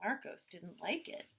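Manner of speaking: usual speaking voice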